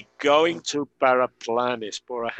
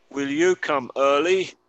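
slow question; 3 semitones higher, 5 dB louder than usual. Shown as a demonstration. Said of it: "escuchar la pronunciación".

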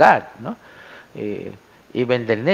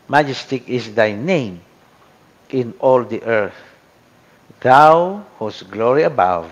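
reading scripture aloud; 6 dB louder than usual. Shown as a demonstration. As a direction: proclaim the verse with reverence. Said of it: "let us see yung invitation to praise God".

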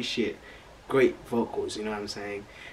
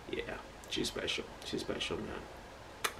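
soft, unvoiced awed whisper; softer whispered praise; 12 dB quieter, slower than usual. Real, not acted. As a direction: whisper it like a secret compliment.

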